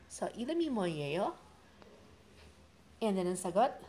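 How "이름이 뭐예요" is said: fast, run together; now at full natural speed. Shown as a demonstration.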